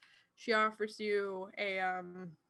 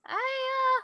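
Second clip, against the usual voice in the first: put-on higher, quick old lady voice; high granny voice; 12 semitones higher, faster than usual. GM voices the granny higher and quicker. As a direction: push it up high and rush it. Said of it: "I'm just gonna sound like this".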